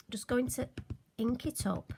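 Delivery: ordinary delivery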